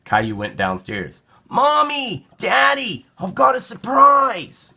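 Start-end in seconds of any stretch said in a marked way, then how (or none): 1.5-4.7 put-on high, loud, eager little boy; excited child calling. Caillou calling his parents, excited high voice. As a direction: call out bursting with news.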